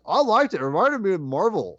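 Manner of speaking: high-pitched mock cheerful opinion; lightly mocking high voice; older brother's approval. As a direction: imitate a brother happily approving. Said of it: "you know what he says".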